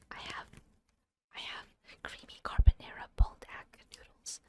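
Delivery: breathy close-mic whisper; ASMR whisper. Pretend. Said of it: "talk really quietly".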